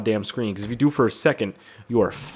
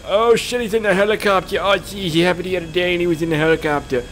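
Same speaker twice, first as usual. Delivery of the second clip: high put-on panicky voice; panicked squeal; 7 semitones higher than usual. Gamer seeing an enemy in a helicopter, high mock panic. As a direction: wail it in comic alarm.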